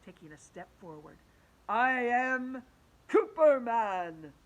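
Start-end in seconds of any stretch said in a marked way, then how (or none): 1.7-4.3 louder, slow booming hero voice; teacher announcing himself to kids, booming; superhero declaration. Acted. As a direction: proclaim it like a comic-book hero. Teacher declares his superhero name.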